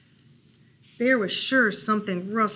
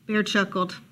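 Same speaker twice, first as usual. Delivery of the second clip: ordinary delivery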